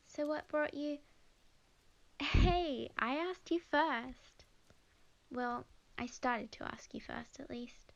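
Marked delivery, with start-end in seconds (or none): 2.2-4.5 playful protest. Acted.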